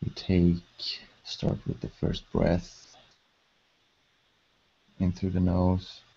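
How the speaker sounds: measured, level delivery, leaving space to breathe; guided meditation. Acted. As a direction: guide them gently into calm, phrase by unhurried phrase.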